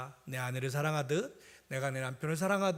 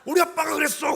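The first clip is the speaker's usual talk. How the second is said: put-on high, loud confident kid's voice; proud child; 11 semitones higher, 10 dB louder than usual. Son answers the older kid, proud high child voice. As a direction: announce it proudly like a little boy. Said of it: "그러는 거예요".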